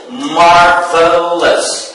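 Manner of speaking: MARVELOUS stretched slowly into mar-ve-lous; syllable by syllable. Shown as a demonstration. Teacher showing three syllables, MARVELOUS said slowly in parts. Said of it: "we have three syllables".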